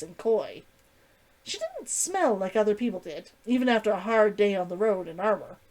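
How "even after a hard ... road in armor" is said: ordinary delivery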